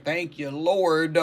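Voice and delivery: slow, lightly put-on thankful man; a hint of a man thanking God, drawn out; mock man's praise. A woman imagining how a man gives praise, lightly put-on. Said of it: "I'm sure he's like".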